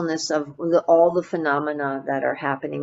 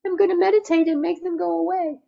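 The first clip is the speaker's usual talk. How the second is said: much higher, fast, airy caricature voice; mock bypasser; 11 semitones higher, faster than usual. Teacher mocking spiritual bypassing, high and quick caricature. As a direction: send up the naive meditator wanting feelings gone. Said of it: "Bypassing is".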